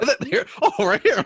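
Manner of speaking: playful pretend search. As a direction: pretend to dig out the pencil. Acting out finding the pencil.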